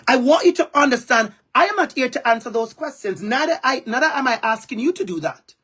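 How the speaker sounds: ordinary talk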